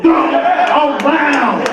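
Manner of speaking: shouting to drivers to go around; slow shouted go around. Retold or imitated. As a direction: yell it slowly at the cars behind. Yelling at stuck traffic.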